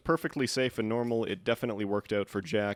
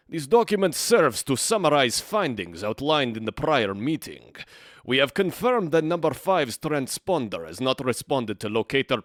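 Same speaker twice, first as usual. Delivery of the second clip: bad Russian accent; 2 semitones higher, 4 dB louder than usual. Let's-player reading a Soviet memo in a bad Russian accent. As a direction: read the memo like a cartoon Russian spy. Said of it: "terrible and potentially offensive Russian accent".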